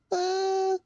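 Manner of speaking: short high laugh of disbelief; exasperated laugh. Real, not acted. Streamer laughing off a frustrating day, short and high.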